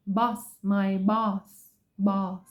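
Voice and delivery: "boss" stretched slowly with an open ah. Shown as a demonstration. Teacher modelling the O word, slow boss. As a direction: draw out "boss" with ah. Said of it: "O sound".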